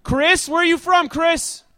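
high, full-volume shout; screaming. Real, not acted. Host screaming up to Chris at his window, shouting. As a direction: holler a question up at a distant window. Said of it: "I will stop screaming at Chris".

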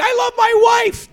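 high, loud, drawn-out comic scream; mock screaming. Imitating a married straight man in costume, mock screaming. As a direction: scream it slowly as a mock celebration. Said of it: "running around screaming".

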